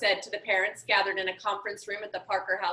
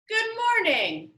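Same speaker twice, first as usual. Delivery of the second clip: high, slow, showy hello; grand greeting; 10 semitones higher, slower than usual. Acted. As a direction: sing out the greeting like a showman. Eccentric billionaire greets parents.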